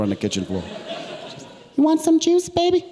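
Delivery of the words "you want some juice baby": very high, put-on woman's voice; girlfriend impression. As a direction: offer it sweetly, pitched way up. Voices girlfriend offering juice.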